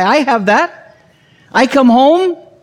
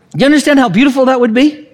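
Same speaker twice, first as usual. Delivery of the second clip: usual speaking voice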